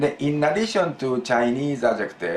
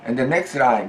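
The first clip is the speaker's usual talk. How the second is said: ordinary talk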